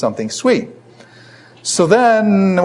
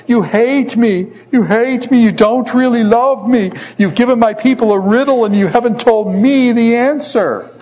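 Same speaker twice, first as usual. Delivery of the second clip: high-pitched whiny wailing plea; hammed-up tearful wife's accusation; mock sobbing; 9 semitones higher, 3 dB louder than usual. A narrator's reading. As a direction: plead and wail up high without real tears.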